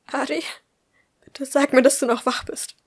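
high, urgent plea; desperate pleading. Acted. Woman texting a friend for help, pleading desperately.

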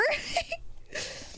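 soft squeaky giggle; real high giggle; high, soft laugh. Real, not acted. Amused during stream.

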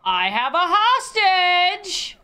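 slow, high, stretched holler; called-out announcement. Pretend. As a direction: holler it slowly to the police. Announcing hostage to police.

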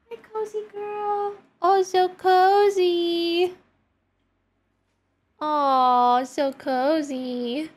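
high, very slow cooing. Real, not acted. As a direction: melt over the cozy cat. Baby talk to the cat.

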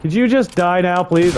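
high, loud pleading shout; frantic high begging; desperate high plea. Real, not acted. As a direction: beg the dinosaur at full volume. Begging the T-Rex to die.

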